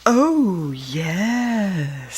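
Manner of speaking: a sly snake, lightly voiced; reassuring snake voice. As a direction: soothe like a cunning snake. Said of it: "Sylvester assured him".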